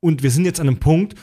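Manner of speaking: normal talking voice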